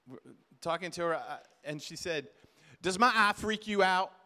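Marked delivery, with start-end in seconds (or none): 2.8-4.1 loud imitation of an Alabama woman's accent; Southern US accent. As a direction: mimic a blunt Southern wife, loudly. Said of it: "this is what she said to me".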